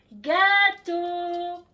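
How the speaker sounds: high, loud sing-song call; calling the dog. Real, not acted. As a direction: call out to a dog across the yard. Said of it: "j'essaye de l'appeler".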